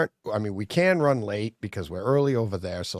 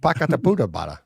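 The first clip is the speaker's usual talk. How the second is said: a touch of Brooklyn in "Pakataputabara"; "Pakataputabara" tossed off with a light Brooklyn twang; Brooklyn accent; 4 dB louder, faster than usual. Shown as a demonstration. Cohost asks him to say the word again, light Brooklyn accent. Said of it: "that sounded almost, uh, Brooklyn".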